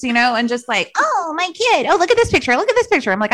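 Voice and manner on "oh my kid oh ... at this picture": high-pitched mock gushing mom; gushing Stepford mom. Mom showing off pictures at the group, mock gushing. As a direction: imitate a mom cooing over her kid's pictures. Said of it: "like, Stepford brides".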